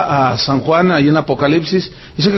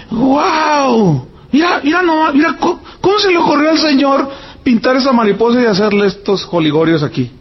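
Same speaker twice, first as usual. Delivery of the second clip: high, fast, near-crying wonder; amazed exclamation; 6 semitones higher than usual. Retold or imitated. Preacher recalling marvelling at a butterfly, high and fast. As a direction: gush at the butterfly's colours.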